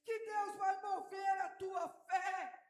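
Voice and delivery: loud, driving sermon voice, words stretched for weight; fervent preaching. Acted. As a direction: preach it out to the congregation with full conviction. Pastor preaching at full force. (pregação inflamada e forte, falando alto para a igreja)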